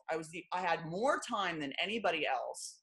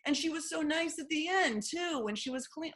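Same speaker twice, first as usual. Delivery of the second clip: sarcastic mock reader; 8 semitones higher than usual. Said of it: "You know what I mean?".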